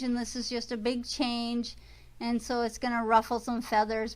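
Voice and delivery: usual speaking voice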